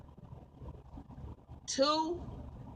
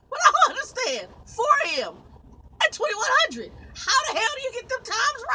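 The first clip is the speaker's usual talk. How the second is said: high, loud incredulous shouting; exasperated rant; 11 semitones higher, 6 dB louder than usual. Real, not acted. Trucker ranting over mismatched load times, exasperated shouting. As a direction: shout your disbelief, pitch shooting up.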